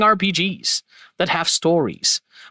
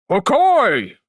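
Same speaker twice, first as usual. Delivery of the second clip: loud villain cry, slightly deeper; big booming cry; a bit deeper shout; 3 semitones higher, 4 dB louder than usual. Shown as a demonstration. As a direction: shout it in a fuller character voice. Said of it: "a little bit more deeper".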